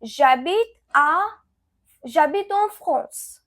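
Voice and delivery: slow, careful French model sentence; slow French demo. Shown as a demonstration. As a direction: say J'habite en France slowly for learners to copy. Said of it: "So I will say".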